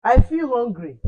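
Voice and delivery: I feel hungry said loud and high. Shown as a demonstration. Teacher repeating I feel hungry loudly for pupils. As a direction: push it out loud for the class. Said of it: "teacher repeats loudly".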